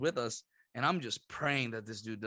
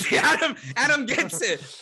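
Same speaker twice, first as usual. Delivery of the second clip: laughing; 12 semitones higher, 10 dB louder than usual. Real, not acted. Laughing aside to cohost.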